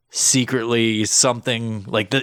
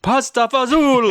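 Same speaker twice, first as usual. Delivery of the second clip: high, drawn-out mock Italian; hammed-up Italian, pitched way up; Italian accent; 14 semitones higher, 4 dB louder, slower than usual. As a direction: stretch it out in a cartoon Italian accent.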